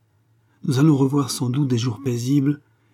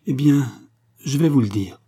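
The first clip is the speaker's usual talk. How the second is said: raised, louder outburst; cried out; 4 semitones higher, 5 dB louder than usual. A narrator's reading. Angèle crying out to Joseph, louder and raised. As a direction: burst out with it, standing up. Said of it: "s'écria-t-elle".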